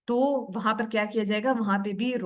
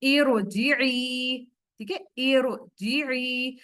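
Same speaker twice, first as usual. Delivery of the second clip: Quran recitation; 2 semitones higher, much slower than usual. Shown as a demonstration. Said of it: "यहाँ देखिए".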